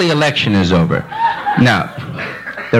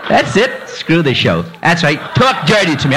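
ordinary talk

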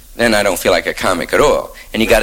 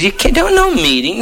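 1 angry censor; 11 semitones higher than usual. Retold or imitated. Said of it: "He says".

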